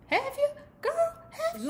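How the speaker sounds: high-pitched prodding; teasing question. Acted. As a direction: prod her in a tiny high voice. Teasing Winter with a pointed question.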